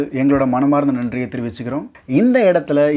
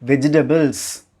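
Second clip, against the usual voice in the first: ordinary talk, nothing marked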